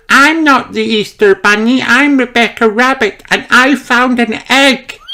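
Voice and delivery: high, excited little rabbit; Rebecca crying out gleefully. A narrator's reading. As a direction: pitch way up, bursting with the news. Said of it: "she cries".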